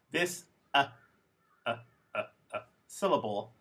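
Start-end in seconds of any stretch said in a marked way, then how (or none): 0.7-2.6 "uh" as a short, neutral vowel; schwa sound. Shown as a demonstration. Teacher isolating the schwa for students, short neutral "uh". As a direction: repeat "uh" in separate little unstressed bursts. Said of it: "that sound is called a schwa".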